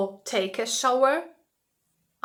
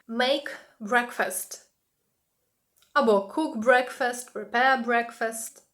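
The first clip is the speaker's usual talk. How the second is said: slow model phrases; slower than usual. Shown as a demonstration. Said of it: "teacher models phrases".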